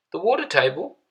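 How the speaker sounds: put-on a cheery English neighbour voice, English accent lifted high; chirpy English accent. A narrator's reading. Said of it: "he said in a chirpy accent".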